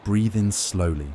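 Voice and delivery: ordinary delivery, nothing marked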